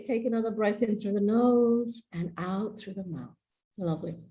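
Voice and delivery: soft, low, soothing instruction; calming breath guidance. Real, not acted. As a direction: ease them down into a breath.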